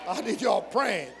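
loud pleading call to the church; urgent plea. Real, not acted. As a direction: call out for their prayers. Preacher urging congregation.

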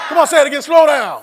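high shout at full volume; rousing call. Real, not acted. Preacher urging congregation to repeat, rousing full-volume shout. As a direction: drive the crowd to echo you.

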